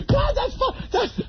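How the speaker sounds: very high mock guffawing; hysterical audience. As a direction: choke out praise through fake laughter. Mocks audience cracking up.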